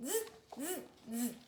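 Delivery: mocking monkey buzz; monkey chatter. A narrator's reading. Monkeys mock the peddler, buzzing monkey chatter. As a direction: buzz back at him like a monkey.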